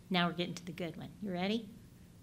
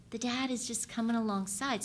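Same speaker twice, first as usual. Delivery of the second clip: ordinary talk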